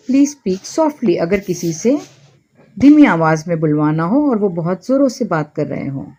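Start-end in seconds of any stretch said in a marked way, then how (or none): 0.1-1.2 very slow, every word laid out clearly; slow model phrase. Shown as a demonstration. Teacher slowly models an English apology for Hindi learners. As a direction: say it slowly so learners can repeat it. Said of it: "So आप कहेंगे".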